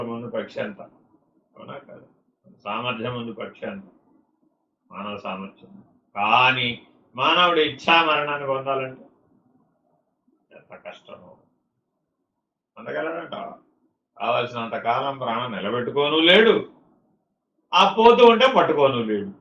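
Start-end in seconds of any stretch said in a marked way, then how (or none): none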